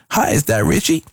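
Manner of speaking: imitating a smooth American singer on the phone, half-managed; attempted American accent. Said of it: "I'm trying to do the accent, but I can't".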